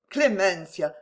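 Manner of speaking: ordinary delivery, nothing marked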